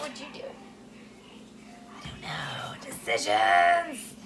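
low, slow dramatic whisper. Real, not acted. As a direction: whisper in mock anguish over choices. Indecisive about pretzel shape.

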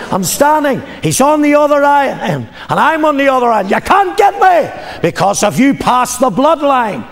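high, loud shout of defiance; defiant preaching shout. Acted. Preacher taunting the devil across the bloodline, defiant shout.

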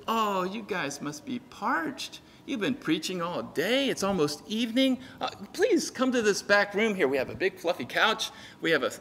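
soft, soothing host, hammed-up; mock-gracious hospitality. Temple captain imagined welcoming tired preachers, mock-gracious and soft. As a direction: play a sweetly solicitous welcome.

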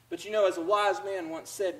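normal talking voice

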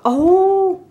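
sudden squeaky oh of delight. Real, not acted. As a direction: burst out at the reveal. Reveal of the card.

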